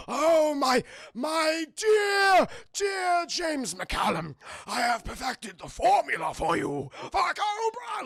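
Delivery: hammy villain, high and loud with drawn-out words; the Doctor. Retold or imitated. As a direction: overact like a masked mad scientist. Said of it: "he talks like this throughout the entire movie".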